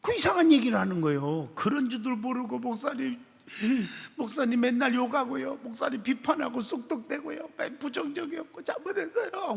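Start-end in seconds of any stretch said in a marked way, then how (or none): 1.5-9.5 higher, rising tearful plea; tearful confession. Retold or imitated. Deacon confessing to the pastor, tearful voice. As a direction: confess it as tears rise. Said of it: "우는 거예요".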